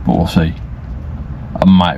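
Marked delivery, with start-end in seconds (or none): 0.0-0.5 slow, drowsy, drifting words; nodding off. Real, not acted. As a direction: talk slowly as if dozing off. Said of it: "falling asleep".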